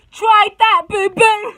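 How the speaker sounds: loud, high, sassy shout; hammed-up shouted dare; mock challenge. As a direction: yell it as a playful taunt.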